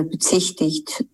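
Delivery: normal talking voice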